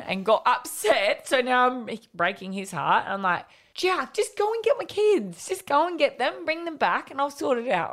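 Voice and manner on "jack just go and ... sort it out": much higher, louder, frantic pleading; urgent high call. Retold or imitated. Mum frantically urging the boy to fetch her kids, high urgent call. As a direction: push him to go now, pitched way up.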